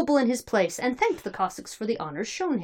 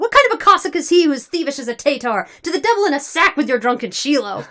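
loud, raised scornful protest; crowd yell; 6 semitones higher, 7 dB louder than usual. A narrator's reading. Crowd scorning a thievish candidate, yelling. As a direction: denounce the candidate before the crowd. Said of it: "yelled the crowd".

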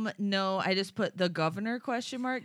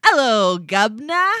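loud, hammed-up fake Cockney greeting; mock Cockney accent; 15 dB louder, slower than usual. Shown as a demonstration. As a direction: show how bad a stage Cockney sounds. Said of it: "if I was doing an accent".

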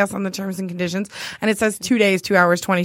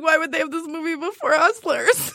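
laughing; 6 semitones higher than usual. Retold or imitated. Laughing retelling her reaction.

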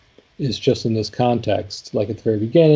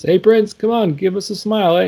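put-on paparazzi voice; 9 semitones higher than usual. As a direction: imitate a paparazzo calling out to a star. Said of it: "he tries to put on this accent".

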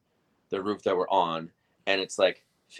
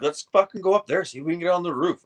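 eager bro; 9 semitones higher, 5 dB louder than usual. Retold or imitated. Rory proposes climbing scaffolding.

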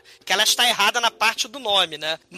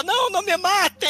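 pleading; 10 semitones higher than usual. Retold or imitated. Acted quote of a pleading victim.

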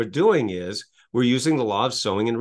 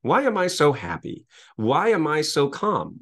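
slow, higher, lifted questions; slow empowering question; 5 semitones higher, slower than usual. Shown as a demonstration. Speaker modeling the empowering questions slowly and brightly. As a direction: savor each question as a sample. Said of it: "you would ask a question like".

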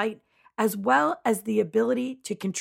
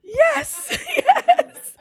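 high, loud laughing yes; 9 dB louder than usual. Real, not acted. Laughing at the auto-tune joke.

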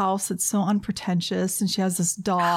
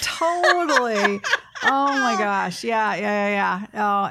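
laughing; slower than usual. Real, not acted. Laughing together.

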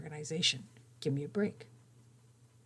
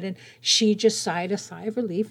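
ordinary talk

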